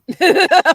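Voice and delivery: a sudden high burst of laughter; loud laugh. Real, not acted. Teasing her partner about cancel culture, bursting out laughing. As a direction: laugh out loud at your own joke.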